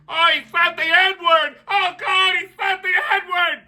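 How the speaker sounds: high, shouted fake horror; hammed-up clutching pearls; shrill mock gasp of offense; mock outrage.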